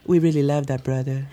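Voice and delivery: lightly put-on sweet voice; mock soft remark. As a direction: mimic a fake tender tribute. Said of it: "little soft remarks like".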